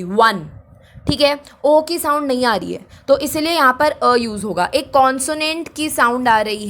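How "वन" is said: "वन" said alone with an opening w; W-sound demo. Shown as a demonstration. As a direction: show the w that starts "वन". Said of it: "डब्ल्यू की". ("वन" में डब्ल्यू की ध्वनि दिखाते हुए)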